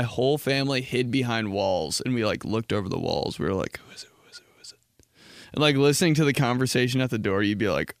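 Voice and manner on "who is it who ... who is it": hushed, repeated whispering; urgent whisper. Acted. Family peeking over walls at the door, urgent whisper. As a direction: whisper it like you're hiding.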